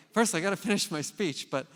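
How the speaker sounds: hurried and thrilled, can't wait; retold excitement. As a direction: speaker begging the superintendent to stay, excited. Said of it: "I'm like".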